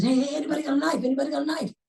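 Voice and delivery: fast, high-pitched appeal; urgent calling. Retold or imitated. Rescuer asking bystanders for a knife, urgently. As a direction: call out to bystanders in a hurry.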